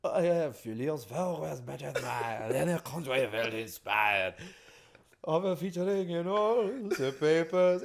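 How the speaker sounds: slow, high hammed-up French accent; a singer showing off a silly French accent. French accent for a musical demo, high and slow. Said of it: "do a French accent".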